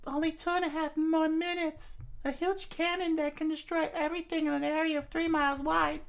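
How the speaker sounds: very high falsetto princess voice; high-voiced princess thinking aloud; fretting princess. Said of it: "She thought to herself".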